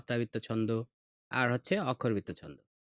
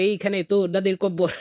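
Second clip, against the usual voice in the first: "এইখানে তোর দাদির কব্বর" rattled off in a quick louder nursery-rhyme beat; wrong bouncy rhythm; 4 semitones higher, 4 dB louder than usual. Shown as a demonstration. Teacher showing the wrong way, louder quick nursery-rhyme chant. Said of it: "তুমি যদি এইভাবে বলো".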